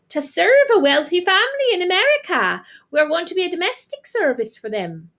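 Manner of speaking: high, loud neighbour woman. A narrator's reading. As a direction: brag over the back fence. Neighbour voiced.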